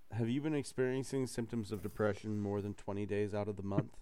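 low, hushed pharma ad narrator; lightly voiced drug commercial; mock ad voice. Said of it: "while you say that".

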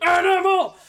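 high, loud wild puppet shout; Animal. Acted. Co-host copying the impression, high loud shout. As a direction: imitate a puppet yelling its name. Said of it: "Next up, we have Animal".

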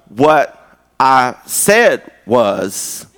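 word-by-word, over-patient explanation; slow, deliberate sarcasm; sarcastically slow. Retold or imitated. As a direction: drag each word out with pointed patience. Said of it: "I say things slower, which is sarcastic".

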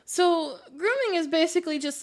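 usual speaking voice

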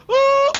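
very high, loud imitated yelp; mock cry. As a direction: echo his yelp back. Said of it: "And you say".

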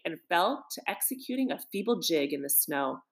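ordinary delivery

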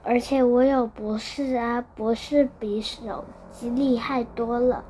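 ordinary delivery

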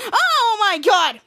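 loud, high, drawn-out mock scream; acted scream. Kid Danger faking alarm to Bianca and Chloe, screaming. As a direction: cry out in exaggerated alarm. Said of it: "Why did you just scream?".

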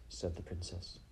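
usual speaking voice

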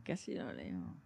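soft, muttered under breath; quiet repeat. Real, not acted. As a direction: drop to a murmur. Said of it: "Y casi lo leo".

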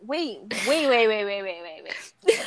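ordinary talk

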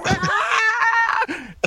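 high, shrieking laugh fit; burst of laughter. Real, not acted. Recalling the flamethrower scene, shrieking laugh. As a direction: crack up helplessly.

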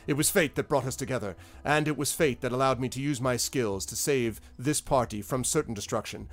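florid, boastful speech in character; grandiose self-styled hero. Acted. Adventurer claims he saved the party, grandly boastful. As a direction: make a grand show of your own heroism. Game master voices Kentabis.

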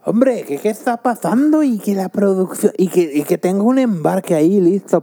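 high hammed-up Castilian Spanish accent; Spain Spanish accent. Imitating the Spanish boss in a high, clownish Castilian accent. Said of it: "un payaso ahí españolete".

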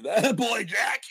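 high, loud shout with a laugh in it; screaming cheer. Retold or imitated. Home viewer cheering Jack on, screaming. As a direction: scream your approval, half laughing. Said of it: "And I'm screaming".

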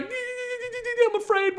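thin, very high stretched-out whimper; exaggerated high, quavering fear; mock terrified church. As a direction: squeal it like a trembling coward. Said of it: "and the church is like".

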